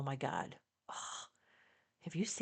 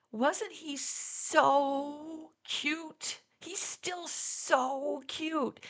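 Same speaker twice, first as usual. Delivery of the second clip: gushing fan; 10 semitones higher, much slower than usual. Retold or imitated. Young fan gushing about Paul.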